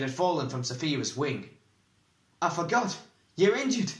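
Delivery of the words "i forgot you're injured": high, loud sudden alarm; exclaiming. A narrator's reading. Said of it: "he exclaimed".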